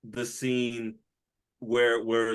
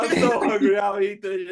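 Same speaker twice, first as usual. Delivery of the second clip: mock hungry whine; 6 semitones higher, 4 dB louder than usual. Quoting Champ line.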